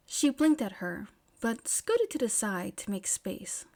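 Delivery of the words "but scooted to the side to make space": plain reading voice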